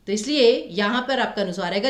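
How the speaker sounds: normal talking voice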